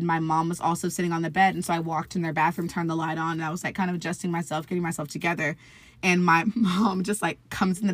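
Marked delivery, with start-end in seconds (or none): none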